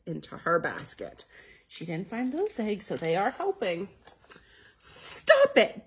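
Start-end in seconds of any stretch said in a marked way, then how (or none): 4.8-5.8 high sharp cry; cried out. Acted. Betty Bunny crying out at her siblings, high. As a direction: stop them short. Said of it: "Betty Bunny cried out".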